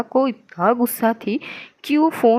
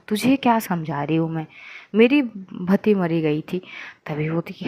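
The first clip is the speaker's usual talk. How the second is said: ordinary delivery, nothing marked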